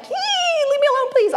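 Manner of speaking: mock exasperation. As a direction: play up the overwhelmed mom. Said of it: "sometimes I'm like".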